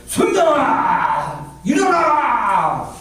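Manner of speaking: long, drawn-out wake-up shout; calling awake. Retold or imitated. God calls a sleeping teacher to wake for service, drawn-out shout. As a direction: holler the name, stretching the endings out.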